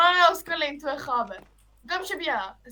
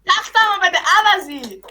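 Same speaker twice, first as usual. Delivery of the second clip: annoyed; 4 semitones higher, 8 dB louder than usual. Real, not acted. Angry demand in game.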